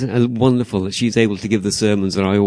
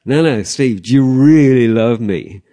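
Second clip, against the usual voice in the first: loud, slow, pleading question; Jesus loudly pressing Steve; insistent loud plea; 4 semitones higher, 10 dB louder, slower than usual. Retold or imitated. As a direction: call out, pressing hard. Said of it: "Jesus insisting".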